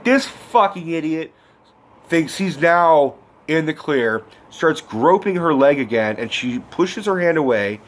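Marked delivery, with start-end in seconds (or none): none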